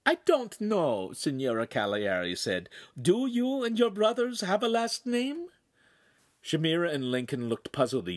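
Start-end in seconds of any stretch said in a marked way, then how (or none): 0.1-1.1 high, slow hostess voice; high-voiced lady pondering; Italian signora. A narrator's reading. Signora answers the girl. 3.0-5.5 high Italian hostess voice; high-voiced lady of the house; questioning signora. A narrator's reading.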